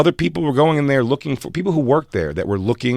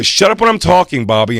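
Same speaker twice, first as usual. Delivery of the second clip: louder, higher mock fury; staged angry yell; 6 semitones higher, 4 dB louder than usual. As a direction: snap it at him in a fake fight. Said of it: "I go".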